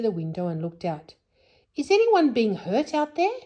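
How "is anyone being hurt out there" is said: high, loud worried call. Acted. Joe calling out the window, high and loud. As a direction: call into the dark night.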